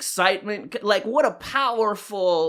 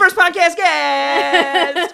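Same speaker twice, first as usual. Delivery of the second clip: much higher, louder, stretched out slowly; big drawn-out game show reveal; hyped-up announcement; 13 semitones higher, 5 dB louder, much slower than usual. Acted. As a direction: hype the guest like a game show host. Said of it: "so excited to welcome".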